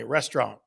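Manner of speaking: restaurant said as "restrahn", middle vowel dropped, no final t; his own way of saying restaurant. Shown as a demonstration. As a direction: say restaurant as "res-trahn", nasal ending. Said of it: "Do I say it funny".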